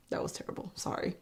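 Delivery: whispered embarrassed aside. Real, not acted. Vlogger cringing at her own accent, whispered. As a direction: breathe it out under your breath.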